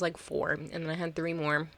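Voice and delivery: usual speaking voice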